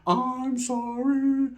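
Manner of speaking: high, soft, very slow plea; pleading puppy dog. Retold or imitated. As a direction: beg like a sad puppy. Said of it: "I was sad with puppy dog eyes".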